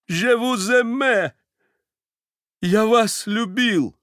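high, slow burst of love; bursting confession. A narrator's reading. Stepan finally bursts out his confession of love. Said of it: "вырвалось у него наконец".

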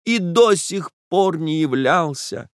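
put-on young woman's strained reply; Nastenka's high voice. A narrator's reading. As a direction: hold back the sob. Отвечала Настенька.

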